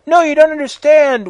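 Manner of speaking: high, loud mock anguish; sad scream, overacted. A narrator's reading. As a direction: wail it out as a hammed-up protest. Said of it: "screamed Draco sadly".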